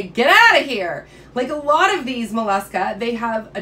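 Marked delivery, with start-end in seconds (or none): 0.0-1.1 loud, drawn-out dismissal; mock rejection. Sea hare rejecting your petting, loud mock rebuff. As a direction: playfully shoo someone away at full volume. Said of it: "they reject your petting and say".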